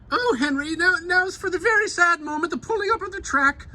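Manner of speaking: a sweet old woman lamenting, lightly hammed; Marge. Marge mourns the track removal.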